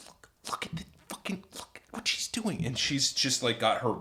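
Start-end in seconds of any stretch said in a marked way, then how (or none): 0.1-2.6 fast, hissed whisper to a friend; frantic whispered nudge; urgent whisper. Retold or imitated. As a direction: whisper it urgently under your breath. Elbows friend, secretive alert.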